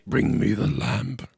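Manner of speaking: booming Aladdin cave echo; lightly voiced magic cave; Cave of Wonders voice. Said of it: "Sound like the cave in Aladdin".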